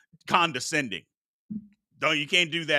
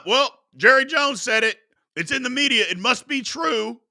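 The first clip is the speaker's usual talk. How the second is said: gullible fans mocked; 6 semitones higher than usual. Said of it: "How they can just absolutely".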